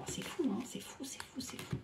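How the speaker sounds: breathless whisper of amazement; amazed whisper. Real, not acted. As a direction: whisper it, stunned by the cards. Amazed at the card message.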